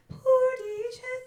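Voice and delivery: very high, loud, slow sobbing; high sob. Shown as a demonstration. Teacher sobbing the phrase to throw the chest voice away, high. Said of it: "to throw that".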